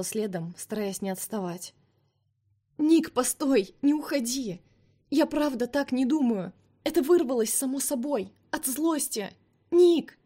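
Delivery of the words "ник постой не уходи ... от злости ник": high, loud desperate pleading; calling after him. Acted. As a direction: chase him with your voice, begging.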